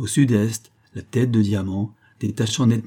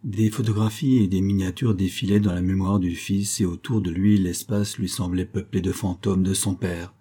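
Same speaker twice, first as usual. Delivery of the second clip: ordinary delivery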